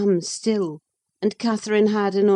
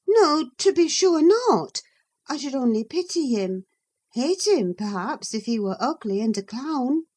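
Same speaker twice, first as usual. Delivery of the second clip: put-on higher, lively young woman's voice; a young lady answering back, pitched high; Catherine; 7 semitones higher than usual. Catherine's voice in the reading.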